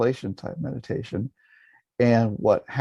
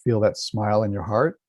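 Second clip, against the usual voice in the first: calm, measured meditation voice with long pauses; guided meditation. Acted. Teacher guiding listeners to relax and feel a smile, calm meditation voice. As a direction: guide listeners gently inward.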